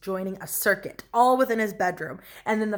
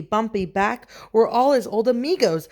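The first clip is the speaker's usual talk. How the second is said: usual speaking voice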